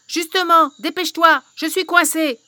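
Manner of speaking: loud impatient hag; urgent witch. Acted. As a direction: hurry her, stuck and panicking. Trapped witch urges the girl.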